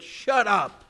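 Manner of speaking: loud sharp stern command; stern shout. Retold or imitated. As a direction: cut the wailing crowd dead. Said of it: "stern voice".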